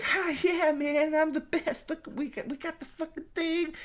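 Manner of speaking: very high, squeaky mock voice; Phoebe Waller-Bridge. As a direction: crow in a silly falsetto. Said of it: "Phoebe Waller-Bridge is like".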